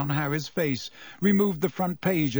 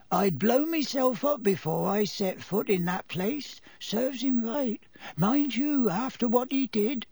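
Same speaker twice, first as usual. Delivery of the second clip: high, ponderous driver character; unhurried, grimly opinionated character voice; Ern's slow voice; 8 semitones higher than usual. A narrator's reading. Driver passes judgement on Azkaban, slow character voice. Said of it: "in his slow voice".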